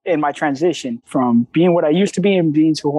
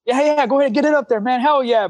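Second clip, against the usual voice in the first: lightly acted, higher-pitched cheering on; coach's encouragement; 7 semitones higher than usual. Coach urging an athlete at the gym, lightly acted encouragement. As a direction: urge them on with a raised voice, mildly hyped. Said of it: "when I started coaching here".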